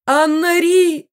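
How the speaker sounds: very high, loud, stretched call; mother calling out. A narrator's reading. Mother searching for her daughter after the collapse, shrieking call. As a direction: wail the daughter's name, drawn out. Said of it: "слышался голос мамы".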